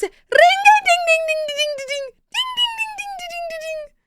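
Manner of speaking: very high squeaky ding ding ding; yelping fox ring-ding; fox sound. Acted. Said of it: "What does the fox?".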